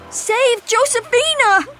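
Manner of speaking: loud, high-pitched plea; desperate cry. A narrator's reading. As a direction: call out urgently for someone to save her. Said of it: "cried Faith".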